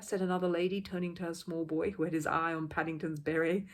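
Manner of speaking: ordinary delivery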